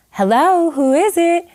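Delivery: high, bright voice answering the door; a woman's high voice asking who knocks; imitated mother at door. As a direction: call out through the door, politely guarded.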